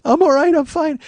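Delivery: very high, loud mock blubber; trying to talk while laugh-crying. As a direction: squeak it out as if sobbing and laughing. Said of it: "I'm trying to talk, but laughing and crying".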